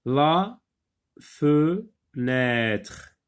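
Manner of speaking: "La fenêtre" split la, fe, nêtre with long pauses; separated, drawn-out "la... fe... nêtre"; syllable by syllable. Shown as a demonstration. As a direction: sound out "La fenêtre" slowly in parts. Teacher models word slowly.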